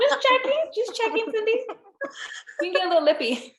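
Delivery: very high sing-song mock warning; mock threatening. Host imitating her prima keeping her in line, mock threatening. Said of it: "And she's just like".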